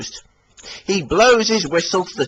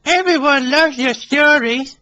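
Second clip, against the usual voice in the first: Billy voice; 13 semitones higher, 4 dB louder than usual. Acted. Billy reassuring.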